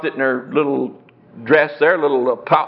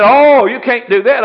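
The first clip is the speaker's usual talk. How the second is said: higher, louder and slow, taken aback; surprised protest; 6 semitones higher, 6 dB louder than usual. Retold or imitated. Buyer protesting the free painting, surprised and loud. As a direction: refuse the gift in disbelief.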